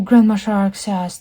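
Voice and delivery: plain reading voice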